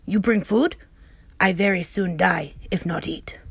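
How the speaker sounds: terse, fading to a whisper at the end; a proud warrior hinting at hunger; Little Bear. Acted. Little Bear asking Omri for food, terse and fading.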